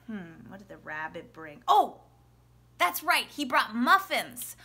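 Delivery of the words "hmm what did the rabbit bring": low, soft musing to yourself; hushed thinking-it-over question; pretend pondering. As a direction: wonder aloud quietly, as if puzzling it out. Muses over the question, waiting.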